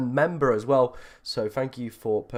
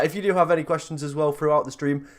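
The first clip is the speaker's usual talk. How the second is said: ordinary delivery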